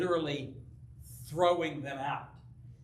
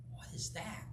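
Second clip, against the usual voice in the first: whispered question to oneself; hushed, acted puzzlement; hushed wondering; 2 semitones higher, 12 dB quieter than usual. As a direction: whisper it as if listening out. Startled by pounding, wondering aloud.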